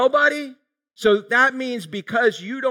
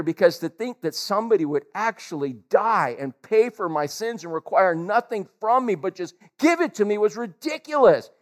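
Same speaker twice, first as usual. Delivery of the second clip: normal talking voice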